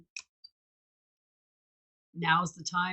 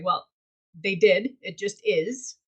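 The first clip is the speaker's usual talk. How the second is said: normal talking voice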